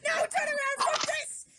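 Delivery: high, loud protest; panicked shout. Acted. Passenger ordering the horses round, high shout. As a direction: yell it at the runaway horses.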